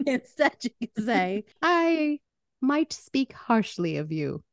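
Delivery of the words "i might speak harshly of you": slow, lowered, prim delivery; mock-polite restraint. Polite rewording of a crude threat, mock-prim. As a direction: drop from the shout into measured civility, spelling out the swear.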